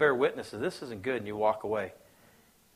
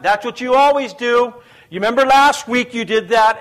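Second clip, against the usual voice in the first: high, loud accusing nag; mock bickering spouse; 8 semitones higher, 11 dB louder than usual. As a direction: snap back like a fed-up spouse. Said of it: "they start yelling with one another".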